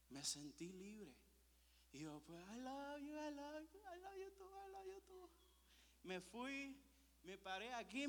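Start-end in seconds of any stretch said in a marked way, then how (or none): 2.5-5.3 squealed, climbing ever higher and softer; mock emotional wail. Overwhelmed son answering his parents, mock emotional wail. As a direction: whine it out, rising into a squeak.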